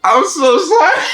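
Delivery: high, laughing fake sob to mama; mock crying. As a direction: whimper an apology while half laughing. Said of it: "I'm over here crying in that cave like".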